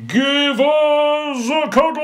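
very high, loud gleeful cry; big man squealing for a hug; gushing big man. Acted. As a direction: swoop in for the cuddle. Big man returns, punchline.

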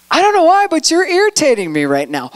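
sudden candid admission; honest confession. Pretend. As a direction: blurt it out honestly.

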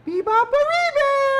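put-on very high, loud baby bear. Shown as a demonstration. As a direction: squeak it like a tiny baby bear. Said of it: "here's what you say".

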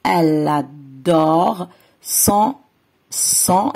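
"elle adore" drawn out, then "son" repeated; very slow word by word demo; slow stretched reading. Shown as a demonstration. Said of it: "ورح تقرأ".

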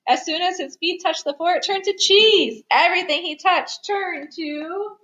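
high, excited storytelling; excited narration. A narrator's reading. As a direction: build toward the big reveal. Excited build to the refrain.

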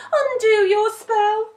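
high, loud, pleading wail; desperate puppy plea; crying out. A narrator's reading. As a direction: beg urgently as a distressed pup. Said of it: "cried Dumpling".

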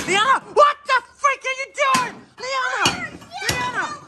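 very high, strained screams of fury; shrieking rage. Acted. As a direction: lose it completely at the kid. Kid smashing plates, dad freaks.

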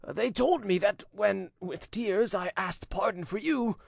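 put-on high-pitched, anguished old father; tearful voice. A narrator's reading. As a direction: beg forgiveness on the edge of tears. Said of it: "he said in a tearful voice".

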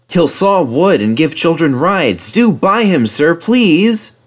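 high, eager, earnest begging; insistent, hopeful appeal; pleading. A narrator's reading. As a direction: plead hard, building to please. Edward begs vicar to buy.

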